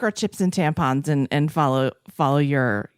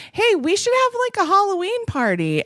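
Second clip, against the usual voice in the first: bright excited; 13 semitones higher than usual. Retold or imitated. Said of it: "when I'm like".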